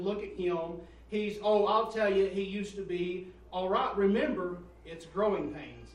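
loud, slow, pounding sermon voice; preaching at full force. Acted. Pastor preaching loudly to his congregation. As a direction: drive each line home to the pews.

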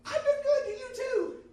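loud, very high imitated woman; high-pitched voice. Preacher imitating coworker wanting the same favor, high-pitched voice. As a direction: screech it in a put-on high voice. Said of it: "had a real high-pitched voice".

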